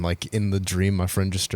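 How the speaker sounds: ordinary talk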